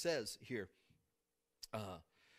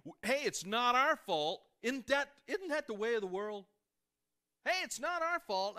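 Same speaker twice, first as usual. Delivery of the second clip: mock excuse; 8 semitones higher, 7 dB louder than usual. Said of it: "They were trying to say".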